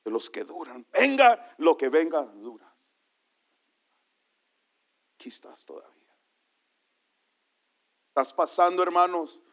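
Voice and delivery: ordinary talk, nothing marked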